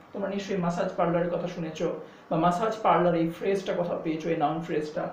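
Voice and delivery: ordinary talk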